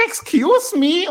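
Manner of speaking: very high, very loud, slow shriek; Vickie Guerrero screech. Retold or imitated. Vickie interrupting the ring, shrill high slow screech. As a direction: screech it like an outraged mother. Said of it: "die hat wirklich eine hohe Stimme".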